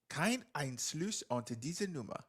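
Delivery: slow, soft automated operator voice; automated German operator announcement; German phone recording. Retold or imitated. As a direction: recite it like the recorded phone message. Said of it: "it would go".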